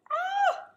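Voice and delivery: high loud mock shriek; mock scream. Teacher pretending to be scared of the bats, high shriek. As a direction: let out a playful pretend shriek.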